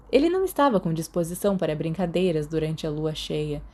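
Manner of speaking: ordinary delivery, nothing marked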